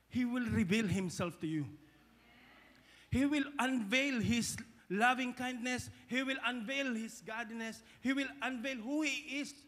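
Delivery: loud, rising preacher's declaration; preaching at full force. Acted. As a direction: proclaim it to the church, building. Preaching builds up.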